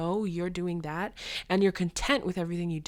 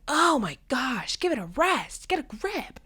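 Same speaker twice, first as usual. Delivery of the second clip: exasperated auntie; 7 semitones higher than usual. Retold or imitated.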